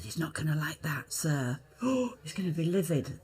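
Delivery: hushed whisper, then a gasp; whispered worry. Retold or imitated. Young actress whispering to the boys in the dressing room. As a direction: whisper it nervously so nobody hears. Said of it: "I said".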